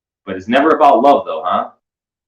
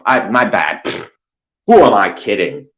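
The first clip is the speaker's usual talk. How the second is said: usual speaking voice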